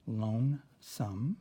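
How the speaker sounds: Lonesome drawn out slowly; slowly stretched. Shown as a demonstration. Teacher stretching the word so kids hear the long O. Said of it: "long O".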